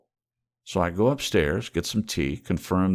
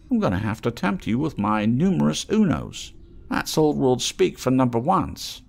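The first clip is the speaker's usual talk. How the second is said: higher, sly haggler's voice; wheedling trader voice; 8 semitones higher, 4 dB louder than usual. Acted. Scruffy trader teasing the mole, higher and sly. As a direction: coax the stranger like a street trader.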